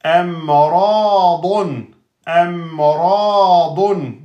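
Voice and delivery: Amradun drawn out slowly, each sound clear; slow careful recitation; slow model recitation. Shown as a demonstration. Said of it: "model recitation of word".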